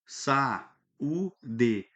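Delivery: "Sa, u, d" as separate little beats; syllable by syllable. Shown as a demonstration. Teacher demonstrating syllable separation, slowly broken up. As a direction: split "Sa, u, d" into slow single syllables. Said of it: "Aí eu fiz a separação silábica".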